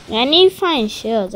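usual speaking voice

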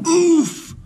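high loud grunt of effort; strained oof. A narrator's reading. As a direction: heave and grunt. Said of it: "moaned Elephant".

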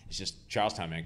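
ordinary talk, nothing marked

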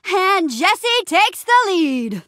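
high, drawn-out mock announcer; playful boast. Adventurer racing a friend ahead, playful boast. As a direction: crow it slowly and high. Mock commentary on own race.

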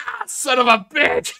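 loud, high, cheated groan of a line; the audience cursing at the twist; mock exasperated yell. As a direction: yell like you were just fooled. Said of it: "we'd all be like".

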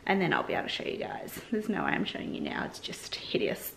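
dropping to a low, fast, soft aside; hushed aside. Real, not acted. To viewers about the hideous bedroom, hushed aside. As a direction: trail off into a hushed whisper.

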